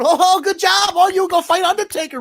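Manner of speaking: high, loud mocking squeal of praise; mocking caricature voice. Mocking the wrestler cheering for John Cena, high and loud. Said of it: "she's left to do the Charlie Chan".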